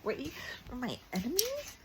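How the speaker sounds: high squeaky mock complaint building to loud no; hammed-up whiny refusal; mock whiny protest. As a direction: whine it like a kid refusing, then yell no. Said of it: "and I was like".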